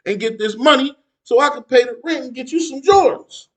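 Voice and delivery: lightly voiced mother, punching it out; mother setting child straight. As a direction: explain the scheme loudly, as mom. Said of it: "She said".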